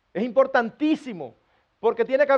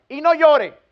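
loud, higher parental command; mock scolding; 4 semitones higher, 5 dB louder, slower than usual. Parent ordering a crying child to stop, mock stern.

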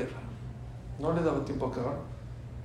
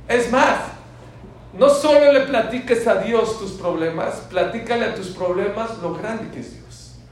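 higher, louder proclaiming; full-force preaching; 6 semitones higher, 9 dB louder than usual. Acted. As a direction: urge the congregation with fire.

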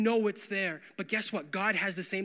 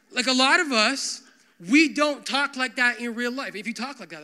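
ordinary delivery

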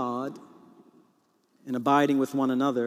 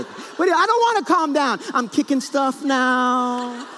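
high, hammed-up angry refusal; mock tantrum; 9 semitones higher, 4 dB louder than usual. Husband refusing to calm down, mock furious. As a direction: rant in pretend rage, stretching the end. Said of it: "I kind of interrupt her".

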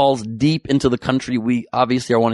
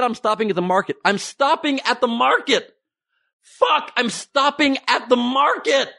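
frustrated phone shouting; 12 semitones higher than usual. Retold or imitated. Comic mimics yelling at a caller on a dropped line, high and exasperated. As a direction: spell it out angrily to a bad line.

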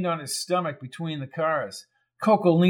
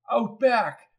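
screamed; 8 semitones higher than usual. A narrator's reading. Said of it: "she screamed".

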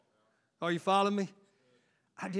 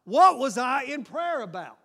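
higher, louder retold snap; exasperated outburst; 7 semitones higher, 10 dB louder than usual. Preacher retelling his distraction at prayer, a loud exasperated outburst. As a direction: throw up your hands at yourself, loud. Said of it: "I snapped and said".

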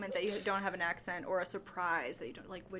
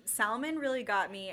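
ordinary talk, nothing marked

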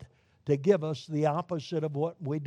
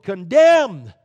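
sudden loud stamp of judgment; judgment pronounced; 2 semitones higher, 12 dB louder than usual. Acted. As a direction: slam the verdict down hard. God writing judgment on humanity.

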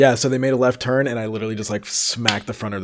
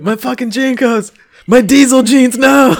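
high, loud laughing lament; laughing wail; 9 dB louder than usual. Retold or imitated. The storyteller grieving his cut jeans, high and laughing. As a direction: mourn the jeans, cracking up. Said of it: "that's basically what it felt like".

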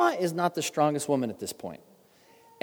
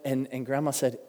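normal talking voice

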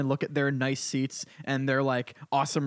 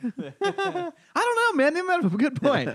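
much higher, giggling through it; high laughing reply; 14 semitones higher than usual. Pretend. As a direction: laugh while you mock-agree.

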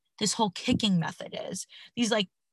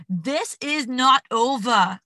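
put-on slow, Schwarzenegger-like stab at an Irish accent; botched Irish accent; 3 semitones higher, 4 dB louder, much slower than usual. Host quoting a fighter's post-fight interview, failed Irish accent. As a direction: try Irish, land on a drawn-out Austrian action hero. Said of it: "That is so Arnold Schwarzenegger".

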